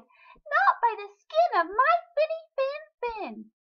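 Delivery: very high, loud little fish; trembling little fish. A narrator's reading. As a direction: squeal it back like a scared fish. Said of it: "The little fish trembled and replied".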